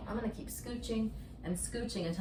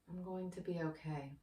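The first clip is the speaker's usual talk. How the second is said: softer, gently offered self-reassurance; soft mantra; 3 semitones lower, 10 dB quieter than usual. Shown as a demonstration. Yoga teacher models the calming mantra for the viewer, softly. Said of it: "to bring in a mantra, very simple".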